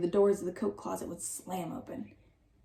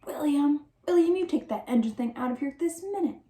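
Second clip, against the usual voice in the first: higher, loud scolding cry; 6 semitones higher, 3 dB louder than usual. Acted. Reverend scolds William from the Bible stand, loud. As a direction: call out sharply like a stern reverend. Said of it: "peer down over the Bible stand and cry".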